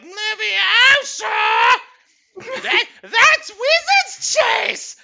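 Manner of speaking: high, loud, slow squeal; mock Hermione shriek. Mocking the kind of guy who shrieks spell names, high drawn-out squeal. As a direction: ham up a nerdy wizard fan.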